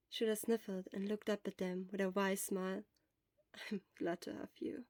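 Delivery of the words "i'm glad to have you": soft and touched. Acted. Sniffling, wry smile.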